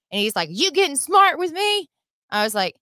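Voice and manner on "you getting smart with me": high, loud mock challenge; confrontational cop. Cop challenging a freezing driver, confrontational. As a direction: imitate the cop getting in her face.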